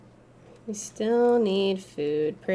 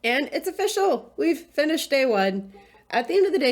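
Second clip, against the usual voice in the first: announcing; 7 semitones higher than usual. Real, not acted. Announcing end of day.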